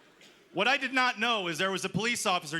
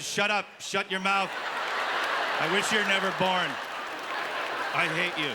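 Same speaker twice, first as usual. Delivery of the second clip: mock tirade. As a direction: play the raging dad for laughs. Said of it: "And he said".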